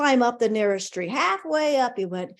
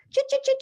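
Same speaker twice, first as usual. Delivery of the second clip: high squeaky squirrel chatter; squirrel chitter; faster than usual. Retold or imitated. Narrator chitters as the pet squirrel in the shop, high and squeaky. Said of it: "like squirrels can do".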